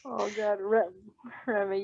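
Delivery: usual speaking voice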